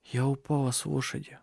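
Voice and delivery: soft, hurt voice; weak and hushed. A narrator's reading. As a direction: speak faintly after the fall. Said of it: "прошептала Сесилия".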